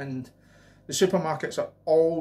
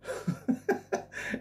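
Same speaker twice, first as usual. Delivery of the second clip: breathy laugh; 6 dB quieter than usual. Real, not acted. Laughs at own impression.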